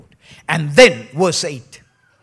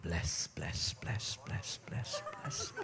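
murmured blessing; 4 semitones lower, 13 dB quieter, slower than usual. Retold or imitated. Imitating a praying person.

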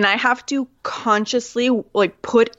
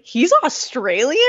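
slow, higher disbelieving question; surprised; 5 semitones higher than usual. Retold or imitated. Guest recalling learning Hugh Jackman is Australian, slow surprised question. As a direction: act out the shock of discovering it. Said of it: "And I was just like".

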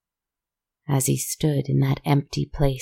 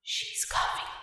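high, breathy ghostly whisper; skeleton whisper; 10 dB quieter than usual. A narrator's reading. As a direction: hiss it like a warning from a skull. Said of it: "the skeleton's voice whispered".

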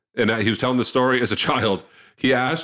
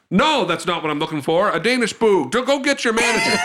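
higher, worked-up imitated father; indignant refusal; 7 semitones higher than usual. Father demanding the baker's manager, indignant refusal. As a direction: refuse to back down, pitch climbing.

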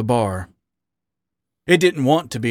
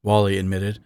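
plain reading voice

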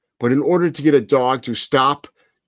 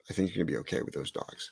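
soft reassurance; 6 semitones lower, 15 dB quieter, much faster than usual. Real, not acted. Reassuring, repeated more softly.

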